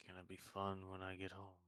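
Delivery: dry, resigned understatement; unenthusiastic. Acted. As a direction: say it with no excitement at all. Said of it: "don't sound too excited".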